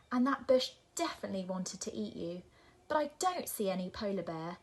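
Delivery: loud, fast, grudging concession; exasperated. Acted. Nora admitting the garden's wonders, loudly exasperated. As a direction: give in crossly, rushing it.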